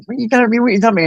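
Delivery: high, bewildered cry repeated; the friend's alarmed voice, imitated; frightened plea. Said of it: "he's going".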